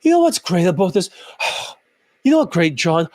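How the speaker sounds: demonstrated deflated speech ending in a heavy sigh; mock depressed sigh after the words. Shown as a demonstration. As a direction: let each phrase drop into an audible sigh. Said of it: "sound like you're sighing at the end".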